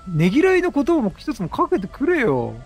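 ordinary talk, nothing marked

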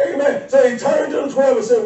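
normal talking voice

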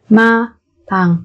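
slow, separated syllables for learners; careful teacher's slow model. Shown as a demonstration. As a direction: spell it out slowly, beat by beat.

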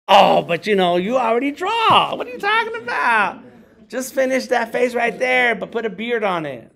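high, loud mocking client voice; mock demanding client. Mimicking a client who wants free art, high and mocking. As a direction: scoff like a pushy cheapskate.

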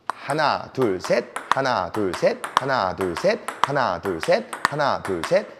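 steady repeated three-count; rhythmic counting. Shown as a demonstration. Coach showing the student the table tennis rhythm, steady rhythmic counting. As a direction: count evenly in a looping beat of three. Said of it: "요런 리듬감".

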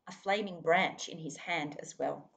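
ordinary delivery, nothing marked

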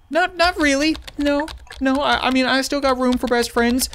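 high-pitched, hedging denial; hesitant reply. Acted. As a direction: answer high, backing away from it. Reading a hesitant reply in game.

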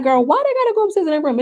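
put-on high, fast, baffled "girl, why"; incredulous. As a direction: act bewildered at the order. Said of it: "And I'm just like".

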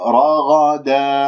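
رغدا slowly, ending on a held aa without n; stop on long vowel. Shown as a demonstration. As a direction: stop on رغدا with a two-beat final vowel. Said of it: "أقف بالمد العوض اللي هو حركتين". (الوقف على رغدا بمد العوض)

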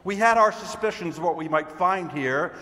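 loud, projected agent with the evidence; emphatic, theatrical announcement; triumphant Mr. Green. Acted. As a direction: present it like a stage detective's big reveal. Performs Mr. Green's monologue.

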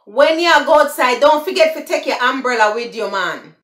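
loud, fast Jamaican patois. Shown as a demonstration. Giving viewers the patois version, in Jamaican patois. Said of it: "that's the patois".

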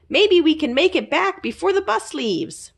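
high, bright-eyed little critter voice; high eager sidekick calling a friend; Zeke the little creature. A narrator's reading. Zeke's voice in the story.

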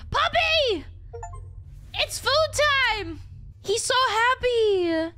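high, delighted coo to a dog; excited puppy talk. Real, not acted. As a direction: call a puppy to dinner in a high voice. Feeding the puppy.

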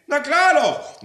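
very high, loud retort; triumphant, insistent yell; shouted contradiction. Acted. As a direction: pounce on the lie. Contradicts the disguised landlord.

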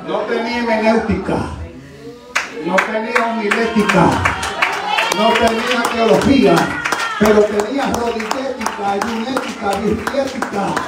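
high, loud rhythmic preacher's cry; impassioned pulpit proclamation; full-force preaching. Real, not acted. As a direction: build the list up to a shouted climax.

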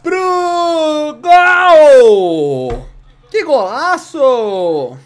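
loud, high goal call; explosive goal commentary. Pretend. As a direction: scream the goal like a TV narrator. Goal in live play-by-play.

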